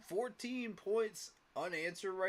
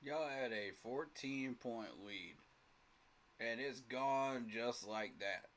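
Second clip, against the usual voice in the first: quiet, lower, slow letdown; deflated; 8 semitones lower, 6 dB quieter than usual. Real, not acted. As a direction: let it sink in, sighing it out. Repeating the lost lead, deflated.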